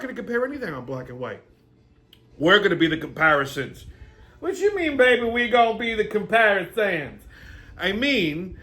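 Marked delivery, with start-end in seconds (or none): 4.4-7.2 higher, puzzled sketch character; high put-on character voice. Character quizzes the host in a higher put-on voice. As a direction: act confused.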